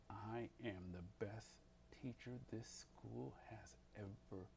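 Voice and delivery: soft, low and slow self-affirmation; calmly hypnotize yourself with it; low, deliberate voice. Shown as a demonstration. Teacher affirming to the subconscious in a low deliberate voice. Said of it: "Low, deliberate voice".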